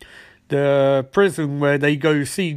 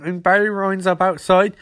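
ordinary delivery

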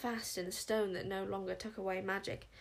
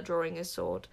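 ordinary delivery

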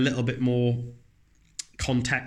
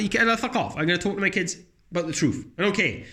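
ordinary delivery

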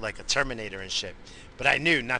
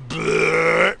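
mock belch; 5 semitones higher, 8 dB louder than usual. Imitating Bobo's belch.

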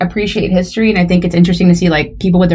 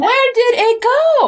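slow, drawn-out high question; slow high mock question; much slower than usual. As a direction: stretch every word, high and incredulous. Same question repeated, drawn out.

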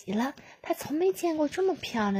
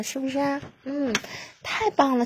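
usual speaking voice

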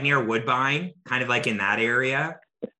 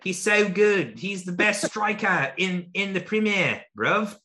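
high-pitched English footy fan; English accent; 7 semitones higher than usual. Acted. Fan praising his favourite striker, high mock English accent. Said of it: "I can do the English one".

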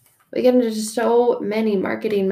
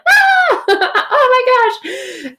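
high squeal into laughter; excited squeal; 5 dB louder, much slower than usual. Real, not acted. Herself to her mom, delighted shriek into a laugh. Excited outburst about the word.

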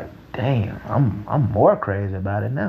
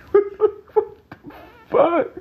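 high squeaky laughing what the fuck; laughing disbelief. Real, not acted.

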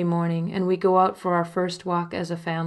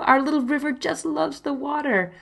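higher, beaming voice; delighted; 8 semitones higher than usual. Acted. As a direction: share the joy. Husband delighted at bath.

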